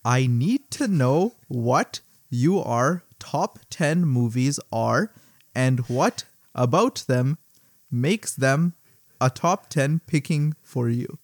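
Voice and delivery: very slow, deliberate reading; word-for-word quote. Retold or imitated. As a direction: spell it out slowly, pausing between phrases. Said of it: "the word for word".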